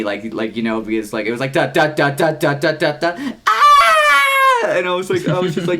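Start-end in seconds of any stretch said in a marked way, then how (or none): 1.5-3.3 loud staccato da-das, climbing in pitch; imitated Jaws theme. Band member recreating the Jaws section before the scream, pounding imitated theme. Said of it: "after the Jaws section ends". 3.5-4.7 long, very high, loud scream; agonized mock scream. Imitating the kid after the Jaws section, long mock agony scream. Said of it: "scream, like, in agony".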